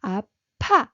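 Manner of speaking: 아파 said loud and strong; 아파 with a firm, loud final syllable; emphatic 아파. Shown as a demonstration. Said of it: "we say".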